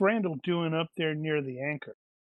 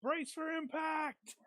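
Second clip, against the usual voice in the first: high, drawn-out pretend shout, held back in volume; hammed-up crash warning; mock alarm call; 11 semitones higher, 5 dB quieter, slower than usual. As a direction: cry out slowly in mock alarm, softly.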